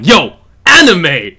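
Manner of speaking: loud, high, drawn-out hype shout. Shown as a demonstration. Commentator demonstrating his dive kick call, hype shouting. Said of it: "I'll start shouting".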